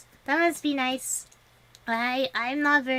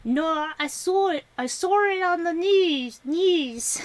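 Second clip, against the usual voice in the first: loud, higher mock Australian accent; 6 semitones higher, 5 dB louder, slower than usual. Shown as a demonstration. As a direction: exaggerate the Australian vowels on "No" and "news". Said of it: "there's a invisible R there".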